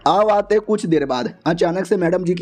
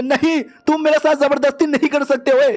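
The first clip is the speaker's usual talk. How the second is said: panicked shout; 8 semitones higher than usual. Acted. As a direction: yell it out in sudden panic. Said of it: "क्या चिल्ला रही हो".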